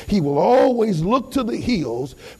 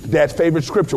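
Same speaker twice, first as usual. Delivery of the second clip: usual speaking voice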